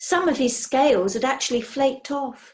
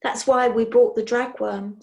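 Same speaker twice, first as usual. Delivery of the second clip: usual speaking voice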